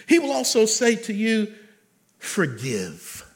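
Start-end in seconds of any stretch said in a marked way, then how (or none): 2.2-3.2 low, drawn-out forgive. Acted. God's one-word command, low.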